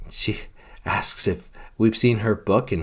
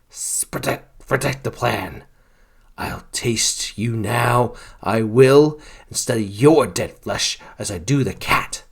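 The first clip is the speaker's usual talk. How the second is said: sinister doppelganger voice; 3 semitones higher than usual. Acted. Priest revealed as doppelganger.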